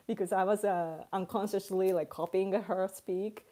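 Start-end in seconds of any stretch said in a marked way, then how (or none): none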